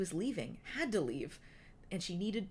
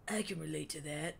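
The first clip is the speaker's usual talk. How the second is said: Wingtip's voice. A narrator's reading. Wingtip's held voice.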